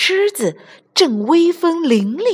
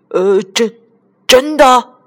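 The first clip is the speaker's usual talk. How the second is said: scared lion; 4 semitones higher, 7 dB louder, slower than usual. A narrator's reading. Frightened lion asks.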